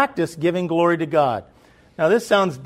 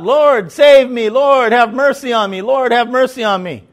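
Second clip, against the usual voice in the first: loud pleading cry, pitched high; retold yell; 7 semitones higher, 9 dB louder than usual. Blind man yelling to Jesus for mercy, loud pleading cry. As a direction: belt it out like a blind beggar begging for mercy. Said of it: "he yells out".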